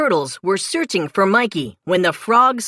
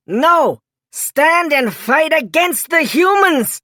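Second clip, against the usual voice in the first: higher, louder commanding shout; 8 semitones higher, 4 dB louder than usual. A narrator's reading. Frog King orders his frogs to fight, a loud high shout. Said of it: "shouted Attila".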